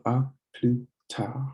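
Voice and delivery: "à plus tard" slowly, no S and no D; silent S and D. Shown as a demonstration. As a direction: model "à plus tard" word by word with silent final consonants. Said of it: "Don't pronounce the D".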